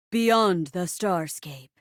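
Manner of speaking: low, slow, grand announcement; dramatic title reveal. Acted. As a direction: intone the sci-fi title low and drawn out. Host announces sci-fi play title.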